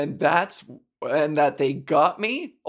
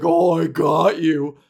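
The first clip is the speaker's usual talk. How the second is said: loud slow mock gloat; loud, slow, mock gloating "I got you"; gloating tease; 7 dB louder than usual.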